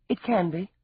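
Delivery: brusque comeback; said shortly. A narrator's reading. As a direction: answer curtly, pitch lifted. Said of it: "she said shortly".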